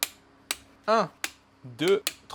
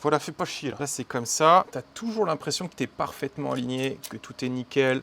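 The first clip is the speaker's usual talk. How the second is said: ordinary talk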